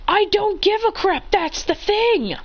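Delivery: high-pitched exasperated outburst; exasperated retort. Acted. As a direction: fire back high, stretching the ending.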